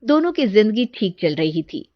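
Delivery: ordinary delivery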